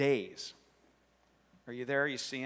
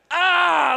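loud, high mock cry of embarrassment; mortified yell; 12 semitones higher, 13 dB louder than usual. Snubbed kid feeling dumb and rejected, mortified yell. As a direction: yell out in hammed-up agony. Acts horror of public embarrassment.

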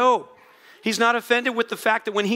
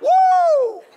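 very high, loud, sustained "woo" whoop; loud exclamation; 8 dB louder than usual. Retold or imitated. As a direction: let out a soaring whoop imitating joy. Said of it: "he exclaimed loudly".

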